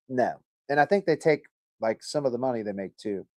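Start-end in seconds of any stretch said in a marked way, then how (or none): none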